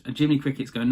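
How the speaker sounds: ordinary talk, nothing marked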